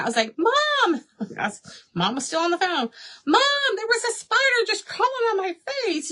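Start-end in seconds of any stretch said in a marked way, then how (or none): 0.4-1.0 high, panicked cry for mom; freaking out. Retold or imitated. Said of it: "I was freaking out". 3.3-6.1 high-pitched panicked report to mom; freaking out. Retold or imitated. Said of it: "I was freaking out".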